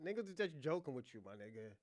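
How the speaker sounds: usual speaking voice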